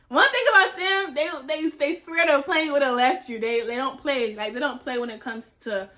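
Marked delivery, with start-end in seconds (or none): none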